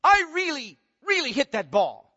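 "I really" pitched high and loud, then dropping for "hit that ball"; emphatic subject stress. Shown as a demonstration. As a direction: insist on "I really" with a high, forceful attack. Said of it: "emphasize the subject in an emphatic way".

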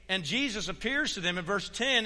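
ordinary talk, nothing marked

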